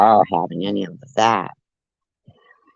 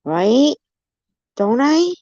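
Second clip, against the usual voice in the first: squeaky; 14 semitones higher, 3 dB louder, slower than usual. Pretend. Fishing for agreement, playful.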